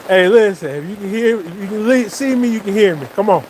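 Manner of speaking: half-laughing, coax the dog. Real, not acted. Scolding the dog playfully.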